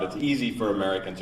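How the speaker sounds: ordinary talk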